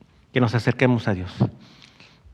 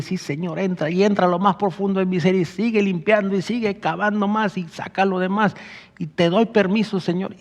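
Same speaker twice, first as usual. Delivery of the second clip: higher, earnest spoken prayer to the Lord; voicing a heartfelt prayer of surrender; 6 semitones higher than usual. Real, not acted. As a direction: pray it out, higher and pleading. Prays aloud in first person.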